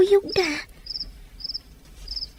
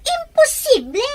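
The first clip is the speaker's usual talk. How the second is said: higher, rising shock at the news; shocked disbelief; 6 semitones higher than usual. Acted. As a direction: hear he's married and refuse to believe it. Learns Jerome has a wife.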